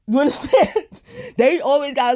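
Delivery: high, laughing question; real laugh while asking the viewers. Real, not acted. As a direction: giggle through it. Laughing at own story.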